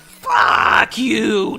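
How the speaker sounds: hammed-up battle cry; defiant shout. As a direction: bellow it back at the boss. Defiant yell at the boss.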